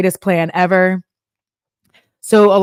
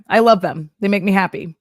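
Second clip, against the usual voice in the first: ordinary talk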